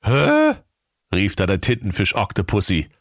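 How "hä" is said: very high, louder puzzled squeak; baffled octopus. A narrator's reading. Octopus baffled by the parrot's crow, high squeak. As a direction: squeak out confusion.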